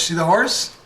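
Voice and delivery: ordinary delivery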